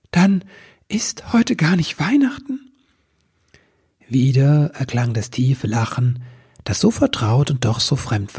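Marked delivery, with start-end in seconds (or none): 0.1-2.7 high, breathless disbelief; astonished elf. A narrator's reading. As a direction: gasp it out in disbelief.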